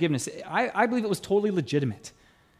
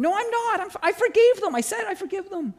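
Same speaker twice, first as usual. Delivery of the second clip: indignant denial; 12 semitones higher than usual. Retold or imitated. Ray arguing back with God.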